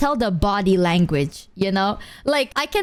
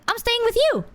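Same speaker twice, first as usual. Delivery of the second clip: high, eager self-invitation; eager blurted reply; 12 semitones higher than usual. Retold or imitated. Streamer instantly inviting herself to stay, eager and high. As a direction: blurt it out high, without hesitation. Retelling her instant cheeky answer.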